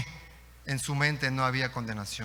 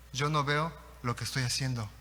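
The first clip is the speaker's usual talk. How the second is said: ordinary delivery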